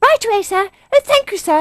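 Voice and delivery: very high, loud, eager youngster; excited little animal voice; small badger. A narrator's reading. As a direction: squeak out grateful obedience. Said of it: "said the small badger".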